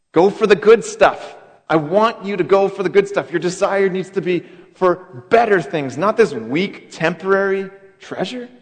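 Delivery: a passionate call to want better things; fervent urging. Retold or imitated. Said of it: "He says".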